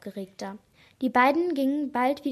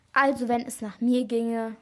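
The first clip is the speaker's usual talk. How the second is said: usual speaking voice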